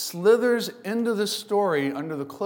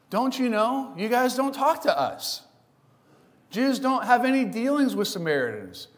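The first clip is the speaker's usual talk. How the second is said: higher, indignant, rising louder to the end; incredulous woman; 6 semitones higher, 4 dB louder than usual. Retold or imitated. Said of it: "The woman asks".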